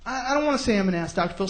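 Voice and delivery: hesitant and cowed, softly; reluctant guest. Retold or imitated. Guest refusing the TV host, hammed-up reluctance. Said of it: "He gets some guy in Oprah".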